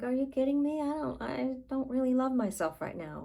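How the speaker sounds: high-pitched, disbelieving objection; acted balking, pitch jumping up; incredulous protest. Voicing the subconscious rejecting self-love.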